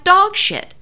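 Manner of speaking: very high-pitched emphatic self-quote; incredulous, insistent retelling; exasperated insistence. Retold or imitated. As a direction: pitch it way up to get through to her. Repeating complaint to unheeding friend.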